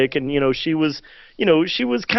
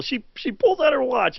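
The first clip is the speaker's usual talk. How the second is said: usual speaking voice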